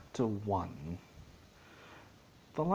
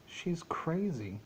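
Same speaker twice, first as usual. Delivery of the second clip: slow, higher delighted cry; mock-joyful exclaim, drawn out; 7 semitones higher, slower than usual. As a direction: savour it like a gleeful kid. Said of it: "he exclaimed with joy".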